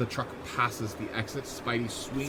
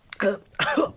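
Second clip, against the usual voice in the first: choking cough; 10 semitones higher, 7 dB louder than usual. Acted. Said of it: "Spider-Man gulps for air".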